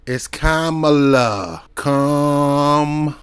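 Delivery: teaching "Kamala" as KAH-ma-la, slow and firm; Kamala with first-syllable stress. Shown as a demonstration. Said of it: "say it with me".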